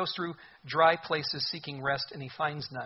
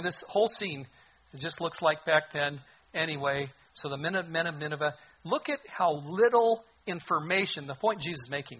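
ordinary talk

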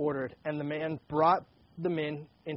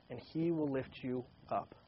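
soft and tender; 3 semitones lower, 8 dB quieter than usual. Real, not acted. Closing the scripture reading.

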